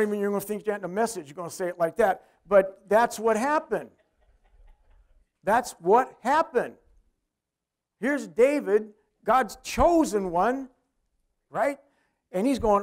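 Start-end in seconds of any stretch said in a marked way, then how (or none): none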